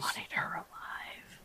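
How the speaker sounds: breathy whispered aside; a whispered correction to yourself. Real, not acted. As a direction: mutter it under your breath, unvoiced. Muttered correction of the bounty.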